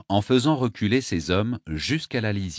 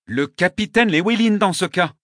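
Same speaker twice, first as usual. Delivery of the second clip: insistent; 11 semitones higher, 7 dB louder than usual. Acted. Wounded governor insists.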